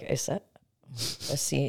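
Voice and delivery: quiet. Retold or imitated. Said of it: "I said it quietly".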